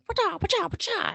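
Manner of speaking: very high, loud kung-fu squeals; toddler karate noises. Retold or imitated. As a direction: chop the air like a tiny ninja. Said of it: "she's like".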